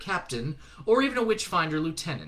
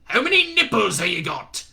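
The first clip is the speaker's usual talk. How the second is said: put-on loud, high testy shout in a regional accent; 8 semitones higher, 8 dB louder than usual. Shadwell losing patience with Newton, shouting testily. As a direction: snap it impatiently. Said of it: "accent was unplaceable".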